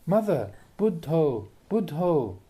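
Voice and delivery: slow, drawn-out coaxing of a dying mother; lightly voiced, stretched-out call to a dying mother; mild urging at deathbed. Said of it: "you go up and say".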